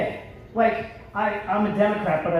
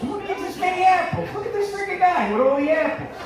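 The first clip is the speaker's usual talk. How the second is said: higher, laughing Philly heckle at a stranger; grocery-line stranger mocked with a higher laughing Philly jeer; mock Philly heckler; 10 semitones higher than usual. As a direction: razz the guy with the apples, laughing. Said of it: "Guy could be just buying apples".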